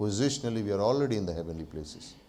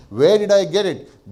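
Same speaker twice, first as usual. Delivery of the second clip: loud preaching question; 8 semitones higher, 9 dB louder than usual. Real, not acted.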